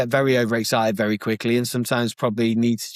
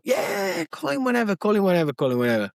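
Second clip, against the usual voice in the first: high, soft start tumbling down; imitated offhand agreement, falling away; busy brush-off; 7 semitones higher, 3 dB quieter than usual. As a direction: brush him off with repeated assent. Busy friend brushing off a request.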